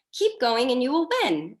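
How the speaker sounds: high, loud, cheery cheer-on; Katie Harlan cheery. Retold or imitated. Coach imitating a leader's motto to her team, cheery. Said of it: "so cheery".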